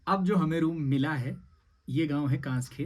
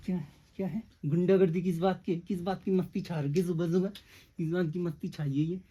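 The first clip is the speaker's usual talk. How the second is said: put-on playful baby talk; 5 semitones higher than usual. Teasing baby about mischief.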